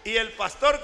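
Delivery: ordinary talk, nothing marked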